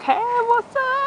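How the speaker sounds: high-pitched pretend dog greeting; voicing the dog. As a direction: pitch it way up as the chilled-out pet. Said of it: "He's, like, taking it all in".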